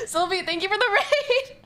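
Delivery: high-pitched, squeaky with laughter; real helpless laughter while speaking; laughing thanks. Real, not acted. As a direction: squeeze the thanks out through giggles. Thanks given through laughing fit.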